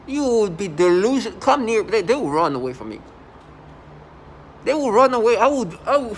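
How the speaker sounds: real heated outburst, pushing the voice up; agitated rant. Real, not acted. Warning off people who would come near, agitated and loud.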